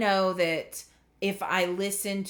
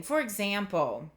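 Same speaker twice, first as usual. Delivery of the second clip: normal talking voice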